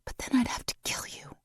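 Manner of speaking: soft unvoiced whisper. A narrator's reading. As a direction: breathe it into his ear. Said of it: "she whispered back".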